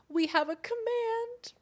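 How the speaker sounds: slow, high singsong announcement; high cutesy voice. Real, not acted. Announcing a command, high singsong voice. Cutesy call about the cat.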